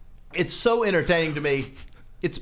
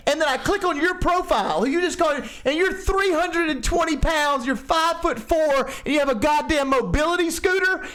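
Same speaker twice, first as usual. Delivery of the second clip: incredulous rant; 8 semitones higher than usual. Real, not acted. Incredulous rant about a commenter.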